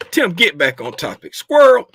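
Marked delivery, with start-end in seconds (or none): 1.4-1.9 loud exclamation. Real, not acted. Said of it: "I got issues".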